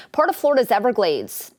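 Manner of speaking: ordinary talk, nothing marked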